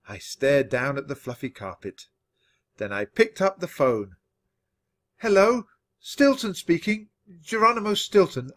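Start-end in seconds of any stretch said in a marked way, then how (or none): none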